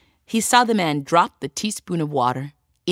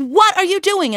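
high, louder baffled shout; 12 semitones higher, 4 dB louder than usual. Acted. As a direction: call out high, bewildered. Tailor runs up baffled.

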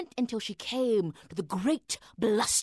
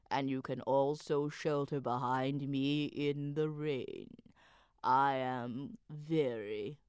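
deep slow wall; 8 semitones lower, 7 dB quieter, slower than usual. A narrator's reading. Wall speaking.

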